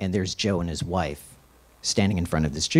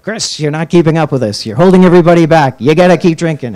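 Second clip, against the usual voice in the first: mock [name] yell; 10 semitones higher, 16 dB louder than usual. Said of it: "he was just like".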